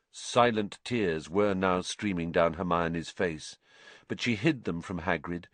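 usual speaking voice

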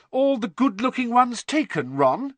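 high-pitched, loud, superior teasing; lofty. A narrator's reading. Hermione needles Ron about his choices, loftily. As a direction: look down on him as you ask. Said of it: "said Hermione loftily".

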